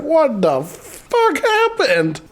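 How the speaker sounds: slow, high, drawn-out disbelief; hammed-up high shock; mock astonishment. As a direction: stretch it out in stunned imitation. Guard walks in on chaos.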